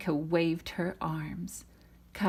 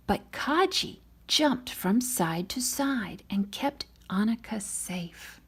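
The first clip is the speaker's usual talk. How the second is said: ordinary delivery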